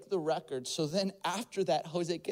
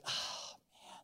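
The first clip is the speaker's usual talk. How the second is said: mock groan; 10 dB quieter than usual. Jose jumped by a salesman.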